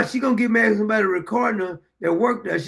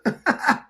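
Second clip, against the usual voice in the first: quick high chuckle; a brief amused laugh; laughing at the woman's outburst; short laugh; 5 semitones higher than usual. Real, not acted. Laughs at clip.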